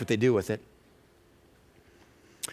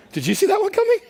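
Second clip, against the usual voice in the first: incredulous; faster than usual. Real, not acted. Stunned preacher aside.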